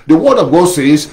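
usual speaking voice

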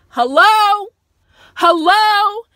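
high, loud incredulous hello; indignant hello. Real, not acted. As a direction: call out the show in disbelief. Mock outrage at the show.

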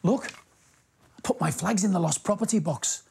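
ordinary delivery, nothing marked

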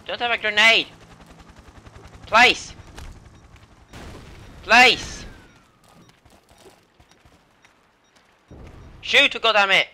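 loud, high in-game callouts; urgent shouting. Real, not acted. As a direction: yell orders in frustration. Calling out in game.

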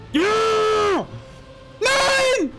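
high yelled yes then no; panicking gamer. Real, not acted. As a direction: swing from joy to despair. Win then loss.

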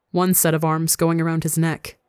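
ordinary delivery

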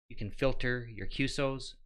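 normal talking voice

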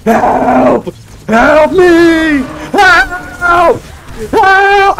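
rising cries of help; panicked shouting for help. Real, not acted. Calling out while dying.

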